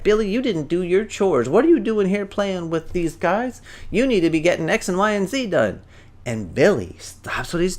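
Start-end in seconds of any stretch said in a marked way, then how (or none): 0.0-5.8 higher-pitched scolding mom. Acted. Mom scolds Billy in front of his friends, scolding. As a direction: scold a child over unfinished chores. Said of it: "she starts to scold him".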